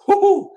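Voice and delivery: loud, very high "woo-hoo"; high whoop. Real, not acted. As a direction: let out a sudden high hoot of disbelief. Exclaims over how overrated Rosen is.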